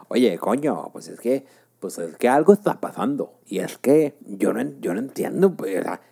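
imitating a Spanish boss in a Castilian accent; Spain Spanish accent. Mexican imitating his Spanish boss in a Castilian accent. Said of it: "te lo voy a imitar porque el güey, eh, era español".

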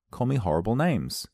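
normal talking voice

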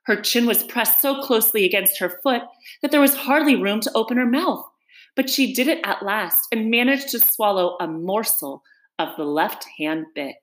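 ordinary delivery

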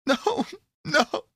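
high-pitched refusal, a touch of a sob; understated tearful protest, pitched high; beginning to sob. A narrator's reading. Pandora refusing the voice in the box, lightly tearful. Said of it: "again beginning to sob".

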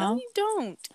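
high retort. Retold or imitated. Retorting the classmate.

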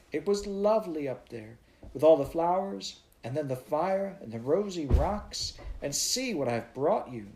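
put-on eager child pointing out the peaks; excited little girl. A narrator's reading. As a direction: gasp at the red mountains. Child voice of Heidi.

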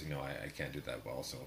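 ordinary talk, nothing marked

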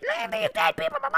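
very high squeaky bird; shrill guardian bird. Pretend. Protective bird voiced.

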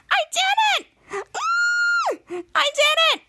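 high, shrieking cry of victory; triumphant squeal. Retold or imitated. Charlotte bursting in to tell Henry, triumphant high squeal. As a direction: squeal it like you just won.

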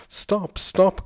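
high, loud plea to stop; crying out. Acted. Landlord begging the men to stop, loud high cry. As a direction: cry out for the beating to end. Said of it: "cried the landlord".

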